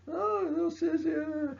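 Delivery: very high, slow falsetto jeer; mocking taunt. Class jeers at Washington for running away, mocking falsetto taunt. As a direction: tease in a drawn-out, hammed-up squeal. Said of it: "I mean, you're like".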